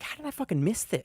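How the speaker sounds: high, hushed mock disbelief at himself; shocked disbelief. Podcast host retelling his own reaction, mock disbelief. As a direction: replay the moment he realized he missed it.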